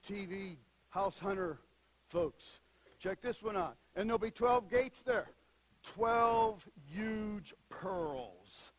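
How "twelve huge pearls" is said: stretched very slow, each word savoured; drawn-out wonder. Real, not acted. Preacher painting heaven's gates, slow wonder. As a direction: linger on every word in awe.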